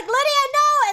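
desperate shout. Retold or imitated. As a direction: scream after her as she goes. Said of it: "And then Dad's like".

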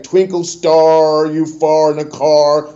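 slow, drawn-out rhyme; mock schoolkid verse, long vowels; corny poem recital. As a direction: stretch out each rhyming word. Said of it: "something corny".

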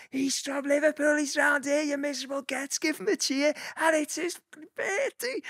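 very high, sustained mock holler; imitating a crowd rallying shout. Pace-bike rider to people in the crowd, high hollered imitation. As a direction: act out hollering over a crowd, pitch held high.

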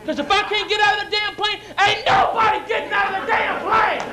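high-pitched ranting protest; worked-up, indignant comic tirade; indignant outburst. Acted. Acted outburst at the stewardess.

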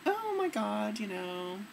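ordinary delivery

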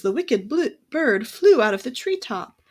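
plain reading voice

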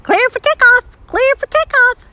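very high, loud parrot squawk; squawking cockatoo. A narrator's reading. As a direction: screech it out like a talking cockatoo, repeating itself. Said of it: "squawked a pink cockatoo".

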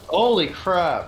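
loud, stretched holy crap; shocked exclamation. Real, not acted. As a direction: blurt it out stunned. Sudden shock in game.

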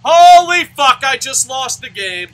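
very loud, high, drawn-out yell; shocked outburst. Real, not acted. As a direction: yell in disbelief at the sudden loss. Suddenly loses the game.

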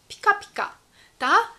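usual speaking voice

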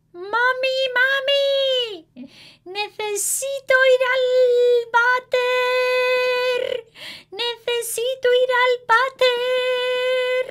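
put-on high, loud sing-song little boy; urgent child. A narrator's reading. Manuelito begging his mother for the toilet, high sing-song. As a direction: whine it in a sing-song like a boy who must go. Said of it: "Manuelito dice".

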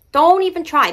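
"Don't" pushed up high and loud, falling after. Shown as a demonstration. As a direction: shout the repeat of "Don't even try" on a high, loud "Don't".

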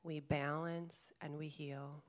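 calm meditation guide, long pauses between phrases; guided meditation. Real, not acted. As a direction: gently guide them inward, leaving space to breathe. Leads a guided chakra meditation.

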